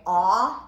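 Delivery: teacher modelling the ah sound. Shown as a demonstration. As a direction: open ah vowel sound. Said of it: "It can say".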